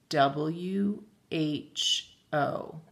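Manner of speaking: each letter drawn out slowly; slow spelling. Shown as a demonstration. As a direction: spell it slowly so they can picture it. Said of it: "Visualize those letters".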